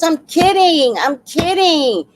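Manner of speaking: high, loud mock holler, slowing on the repeat; mimicked loud protest. Imitating neighbor caught calling her thief, loud protest. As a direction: imitate her frantically backpedaling at full voice.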